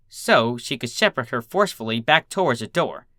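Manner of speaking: usual speaking voice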